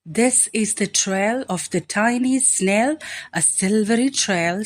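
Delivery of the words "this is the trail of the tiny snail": ordinary delivery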